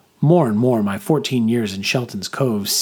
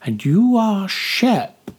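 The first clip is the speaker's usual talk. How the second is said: declaring fortune teller; 9 semitones higher, much slower than usual. A narrator's reading. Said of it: "she declared".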